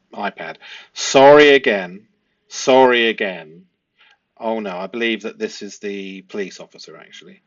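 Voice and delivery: usual speaking voice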